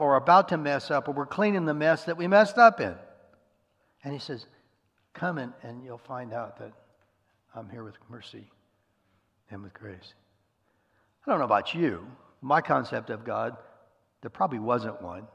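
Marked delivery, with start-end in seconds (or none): 5.1-10.1 soft, hushed welcome from God, much quieter; gentle inviting God. Retold or imitated. God welcoming the weak sinner in, hushed and tender. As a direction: draw them in softly with mercy.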